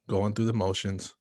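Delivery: usual speaking voice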